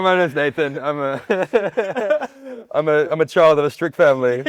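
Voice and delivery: laughing mock therapy-group introduction; mock confession.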